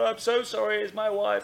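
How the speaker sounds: high-pitched mock pleading; retold flustered apology. Retells pleading with police officer.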